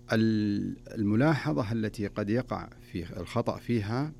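usual speaking voice

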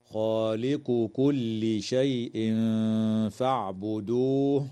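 slow chanted recitation, the tanween in شيء فاعبدوه hidden with a nasal hum; ikhfa with nasalization. Shown as a demonstration. Teacher demonstrating ikhfa to students, slow nasalized recitation. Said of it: "إخفاء حقيقي للتنوين عند الفاء".

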